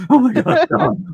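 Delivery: loud, high mock melodrama; hammed-up audiobook heroine. Teasing co-host about the audiobook, mock melodrama. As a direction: cry it out like a bad audiobook. Mocking the audiobook character's line.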